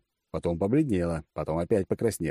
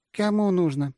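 woman's higher surprised question; удивление; 11 semitones higher, 3 dB louder than usual. A narrator's reading. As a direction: ask it high, taken aback. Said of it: "удивилась женщина". (удивлённо, высоким женским голосом)

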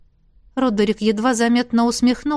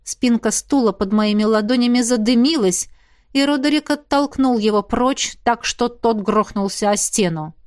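usual speaking voice

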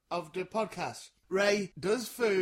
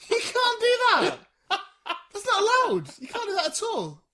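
laughing shout; 12 semitones higher, 9 dB louder than usual. Real, not acted. Laughing outburst at co-host's act.

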